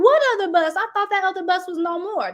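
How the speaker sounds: shocked disbelief. Retold or imitated. Mom learning her daughter took another bus, high shocked outburst. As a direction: blurt it out, stunned and high.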